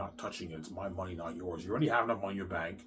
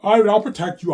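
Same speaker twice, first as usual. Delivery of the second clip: loud protective call; 12 semitones higher, 17 dB louder than usual. Acted. Voices a protector.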